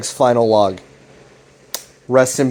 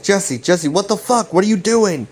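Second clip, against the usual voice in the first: retold panic, pitched well up; mock panicked cries at a betraying friend; freaking out; 7 semitones higher than usual. Locked in the basement, Greg calls out to Jesse in mock panic. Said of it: "Greg starts freaking out".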